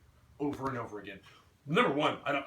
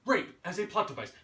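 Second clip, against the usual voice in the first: usual speaking voice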